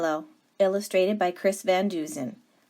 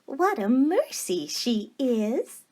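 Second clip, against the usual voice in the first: slow, higher and fond; tender Baby Lincoln; 6 semitones higher, slower than usual. Acted. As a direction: say it with a grateful sigh of wonder.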